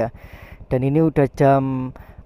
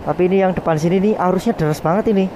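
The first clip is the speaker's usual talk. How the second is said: excited; 5 semitones higher, 6 dB louder than usual. Real, not acted. Riding down a steep flooded track.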